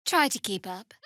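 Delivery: ordinary talk, nothing marked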